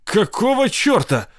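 jolted into a high cry; startled gasp. Acted. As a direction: Sid gasping when the shaking hits. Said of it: "Сид снова ахнул".

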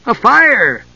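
high, bright cry; eager exclamation. A narrator's reading. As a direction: jump in with glee. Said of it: "Tass filled in the blank".

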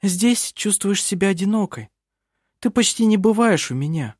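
a hint of a young woman, gently. A narrator's reading. Vinga voiced higher.

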